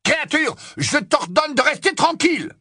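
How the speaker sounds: very high, loud yell; shouted order. Acted. Harry yells at Kreacher to lie still. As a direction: yell over the screams to pin him. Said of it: "Harry hurla plus fort qu'eux".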